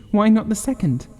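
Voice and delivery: very high, feverish demand; urgent high question. Acted. Wife presses husband feverishly, high and urgent. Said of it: "said the old woman feverishly".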